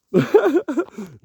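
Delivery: sudden loud bright laugh; burst of laughter. Real, not acted. Sharing a secret with viewers, bursting into loud laughter.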